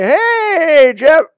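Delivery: high, loud friendly hello; cheery greeting. Retold or imitated. Greeting Jeff at church, high bright call.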